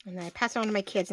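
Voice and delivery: ordinary talk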